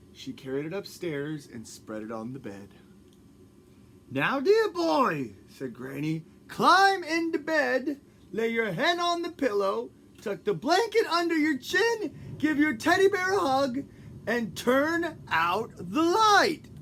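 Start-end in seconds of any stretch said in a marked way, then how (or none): 4.1-5.4 high, loud doting granny; grandma voice. Acted. 6.5-16.7 high, loud doting granny; grandma voice. Acted.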